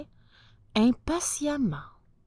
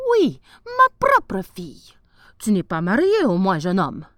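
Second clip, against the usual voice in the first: a grand ruler desperate to buy the rat-killer; lofty sultan voice, raised and eager; 2 semitones higher, 6 dB louder than usual. Acted. Sultan rewarding the travellers.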